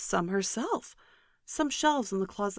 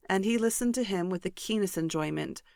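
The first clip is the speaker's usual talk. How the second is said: ordinary delivery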